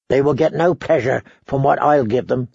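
ordinary delivery

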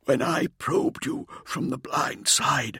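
strained, faltering voice, near tears; struggling to speak. A narrator's reading. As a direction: push the words out, barely holding on. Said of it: "struggling to speak".

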